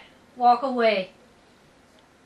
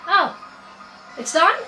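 excited surprise; 7 semitones higher than usual. Real, not acted. Thinks the puzzle is solved.

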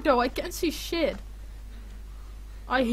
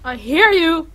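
startled call; 4 semitones higher, 10 dB louder than usual. Real, not acted. Hears monster.

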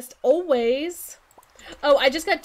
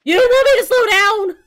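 loud, high, escalating mock panic; acting out a panic-attack outburst; retold panic; 7 semitones higher, 12 dB louder than usual. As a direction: build to a frantic high shout. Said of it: "it's going into panic attack mode".

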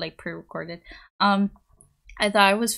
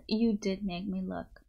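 ordinary delivery

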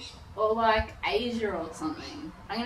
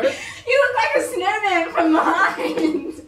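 laughing; 9 semitones higher, 5 dB louder than usual. Real, not acted. Teasing friend covered in glitter.

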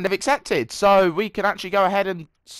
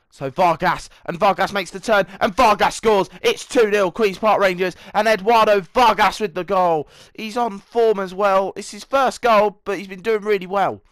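loud, high build to the goal call; excited match commentary; 5 semitones higher, 8 dB louder than usual. Acted.

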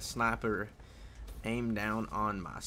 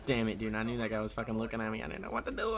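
usual speaking voice

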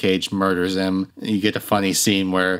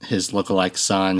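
ordinary delivery

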